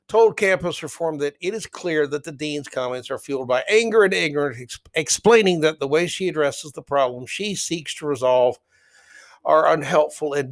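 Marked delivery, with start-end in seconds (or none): none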